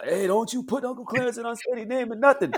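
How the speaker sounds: mock scolding father voice. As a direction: play the angry pops shouting at mom. Pops scolding mom in story.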